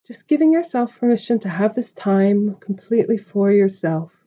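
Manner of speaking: calm, spacious meditation delivery; guided meditation. Acted. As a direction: guide the listener gently into stillness, stretching the breath cues. Leads a meditation.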